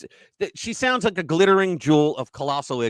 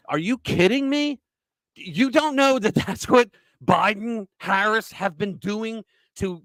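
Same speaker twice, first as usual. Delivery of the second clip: ordinary delivery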